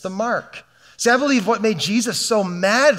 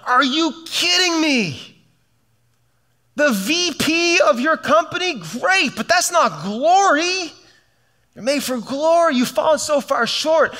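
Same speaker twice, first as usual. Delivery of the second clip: God incredulous; 6 semitones higher than usual. Retold or imitated. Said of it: "And he's like".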